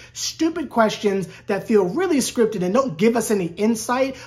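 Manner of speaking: ordinary talk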